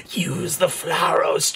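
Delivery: slow, drawn-out mimicry; mock ominous voice. Player parroting the game's cryptic instruction, slow mock-ominous voice. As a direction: intone it slowly, mocking a game character. Mimics the game character's hushed line.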